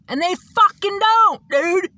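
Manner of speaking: high, loud, drawn-out exclamation; emphatic outburst. Real, not acted. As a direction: burst out in exasperated insistence. Indignant punchline, then repeated low.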